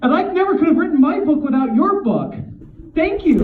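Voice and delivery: high, loud rush of praise; acting out frantic, grateful flattery; gushing fan. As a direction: plead your admiration at full pitch. Retells placating the glowering author.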